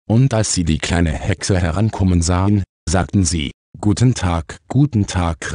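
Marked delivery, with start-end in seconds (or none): none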